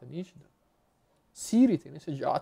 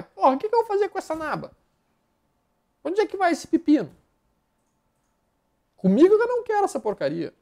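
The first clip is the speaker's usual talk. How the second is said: mock exasperation; 11 semitones higher, 4 dB louder than usual. Mock outrage at messy stock.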